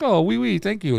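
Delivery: put-on high-pitched dog with a French accent; dainty, high French-accented thanks; little French accent. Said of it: "he's got a little fucking French accent".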